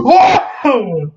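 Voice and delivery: high, drawn-out mock shout of "whoa"; exaggerated outcry of disbelief; hammed-up high "whoa"; shocked whoa. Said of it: "I'm just like".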